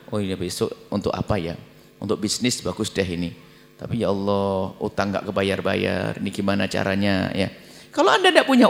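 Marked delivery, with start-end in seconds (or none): 0.1-7.5 low, hushed scheming to oneself; scheming mutter. Retold or imitated. A man plotting a new loan right after his prayer, hushed and sly. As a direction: muse slyly under the breath about the next loan.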